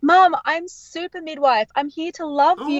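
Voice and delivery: a hint of a chirpy kid announcing himself; proud little boy. Son in cowboy costume comforting his mum, lightly childlike.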